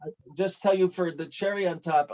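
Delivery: ordinary talk, nothing marked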